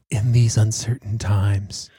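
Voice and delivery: slow, lightly voiced ad announcer; mock a solemn TV ad read; a hint of a somber car ad; commercial voice-over. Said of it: "And every commercial".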